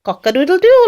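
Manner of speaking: high, loud crow; rooster crow. A narrator's reading. Reader reviews the rooster sound, loud high crow. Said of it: "like a rooster".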